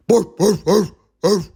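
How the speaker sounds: loud barks; barking across the countryside. Acted. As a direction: bark like a big dog. Dogs bark in reply.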